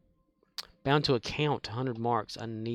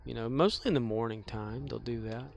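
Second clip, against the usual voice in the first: ordinary talk, nothing marked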